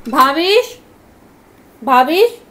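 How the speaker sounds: loud call with rising end. Real, not acted. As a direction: call the name out to fetch someone. Calls partner from another room.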